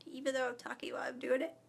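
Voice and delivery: fast whisper on held breath; holding breath. Real, not acted. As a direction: squeeze words out while holding breath. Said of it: "and I'm holding my breath".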